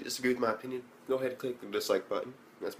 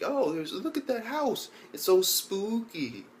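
mock spooked awe; 7 semitones higher, slower than usual. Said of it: "it was just like".

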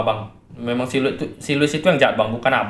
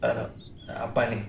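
whisper; 5 semitones lower, 13 dB quieter than usual. Acted. Waking up tied, confused.

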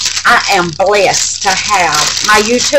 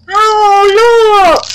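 high, loud, drawn-out exclamation; excited delight; 11 semitones higher, 6 dB louder than usual. Real, not acted. Crafter showing viewers her snowflake gift, excited delight. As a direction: cry out in real delight.

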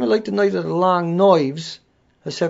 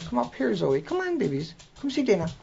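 high, softer pet-talk; coaxing pets; 7 semitones higher, 3 dB quieter than usual. Real, not acted. Host interrupts talk to call Zoe up, high coaxing. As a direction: coax the babies over, high and soft. Turns aside to coax pets.

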